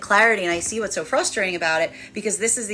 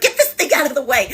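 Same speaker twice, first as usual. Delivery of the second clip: mock fed-up brushoff; 5 semitones higher, 5 dB louder, faster than usual. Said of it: "someone being in a haunted house too long, being like".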